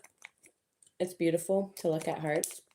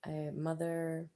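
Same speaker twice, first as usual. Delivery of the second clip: ordinary talk, nothing marked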